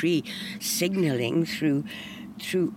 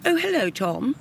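bright, raised posh greeting; 7 semitones higher than usual. Retold or imitated. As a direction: greet him like a posh Londoner. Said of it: "in a very posh voice".

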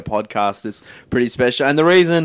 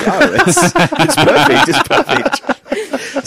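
high laughing, drawn out; 13 semitones higher, 3 dB louder, much slower than usual. Real, not acted. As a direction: laugh through the words. Laughing through the words.

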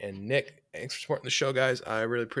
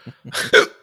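breathy laugh; 11 dB louder than usual. Real, not acted. Laughing fit mid-conversation.

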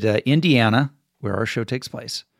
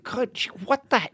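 startled groggy reaction; 10 semitones higher, 5 dB quieter than usual. Retold or imitated. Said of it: "and you're like".

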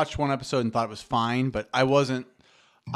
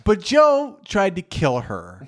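slow, drawn-out punchline; slow emphatic punchline; 5 semitones higher, 4 dB louder, much slower than usual. Real, not acted. Friend recapping Tiger King, slow punchline. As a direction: lay the punchline out slowly.

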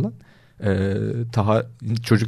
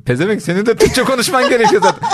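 high-pitched comic scolding, half laughing; mock emperor; 10 semitones higher than usual. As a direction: send up an indignant emperor in a raised pitch. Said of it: "imparator şey diyor ya". (tiz, gülerek azarlayan imparator taklidi)

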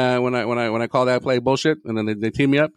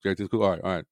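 imitating E-Man, lower and softer; lightly done low, soft E-Man voice; E-Man impersonation; 5 semitones lower, 7 dB quieter than usual. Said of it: "Don't get me started with an E-Man impersonation".

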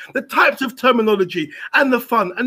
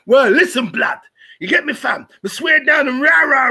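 imitating London street slang, louder; mock roadman slang, shouted; south london accent; 5 semitones higher, 3 dB louder than usual. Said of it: "That is not me saying there's no space for".

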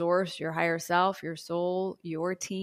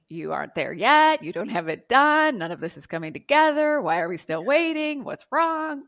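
loud, rising nagging head voice; loud fretting inner critic; anxious inner critic; 5 semitones higher, 7 dB louder than usual. Pretend. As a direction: voice the worried mind, pushing. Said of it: "Your head's like".

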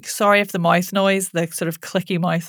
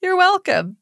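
playful reveal; 3 semitones higher than usual. Pretend. Playful joke about free.

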